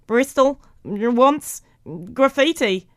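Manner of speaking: disjointed words, each pushed out separately; spliced tape playback. A narrator's reading. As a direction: imitate a choppy edited recording. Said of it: "after a little editing".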